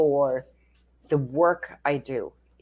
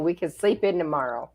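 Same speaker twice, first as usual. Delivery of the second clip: normal talking voice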